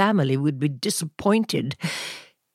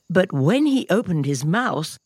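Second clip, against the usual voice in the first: plain reading voice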